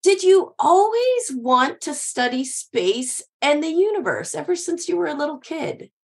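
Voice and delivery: ordinary talk, nothing marked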